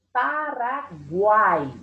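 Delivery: "Paraguai" drawn out slowly as pa-ra-guai; separação silábica. Shown as a demonstration. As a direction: stretch "Paraguai" syllable by syllable, lingering on guai. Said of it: "quando eu separo, quando eu pronuncio". ("Paraguai" bem devagar, sílaba por sílaba)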